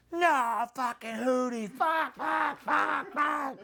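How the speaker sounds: high frantic swearing; panicked cursing. Retold or imitated. Character in a horror scene panicking, high frantic cursing. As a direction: yell the curses in a panic. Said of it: "the jump cuts of like".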